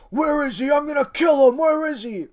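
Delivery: high, loud, raging yell hunting for someone; hammed-up high-pitched shouting rage; furious Damian shout. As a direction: bellow it like a wrestler out for blood. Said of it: "I'm not good with the voices".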